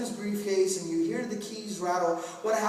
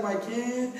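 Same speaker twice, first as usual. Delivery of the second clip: lightly voiced doting parent; 2 semitones higher, 4 dB louder than usual. Doting employee explaining lateness to boss, mock gushing. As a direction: playfully gush over the kid, stretching the ending.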